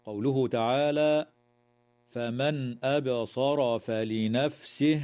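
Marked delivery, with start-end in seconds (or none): 2.1-5.0 slow recitation, the ن of فمن pronounced clearly before أبصر; clear nun recitation. Shown as a demonstration. Teacher demonstrating clear nun to students. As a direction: say the ن of فمن distinctly, no nasal hum. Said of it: "إظهار حلقي للنون الساكنة".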